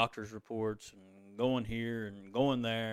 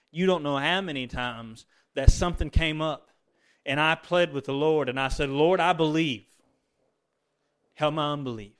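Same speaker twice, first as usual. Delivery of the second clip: emphatic plea; 4 semitones higher, 8 dB louder than usual. Retold or imitated. Preacher recounts his pleading prayer.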